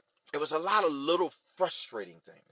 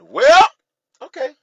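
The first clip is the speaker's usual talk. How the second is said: loud, high-pitched yelp of acceptance; exaggerated reaction; 21 dB louder, slower than usual. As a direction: burst out high and loud in reply. Acting out own reply to the Lord.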